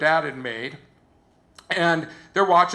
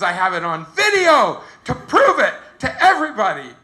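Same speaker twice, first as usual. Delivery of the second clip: high, loud proud boast; acted triumph; 4 semitones higher, 5 dB louder than usual. Contestant boasts that she laughed on TV, high loud triumph.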